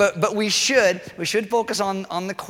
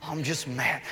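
grumpy. Retold or imitated. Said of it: "I lash out and I get angry".

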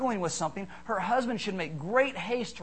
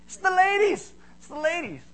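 high mock exclamation; 11 semitones higher, 5 dB louder than usual. Punchline about crying ladies.

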